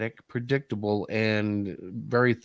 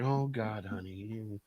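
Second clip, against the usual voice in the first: softer, fading to almost nothing; weary sigh; 4 semitones lower, 14 dB quieter, much slower than usual. Retold or imitated. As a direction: let it die out, fondly exasperated. Wife's exasperated reply retold.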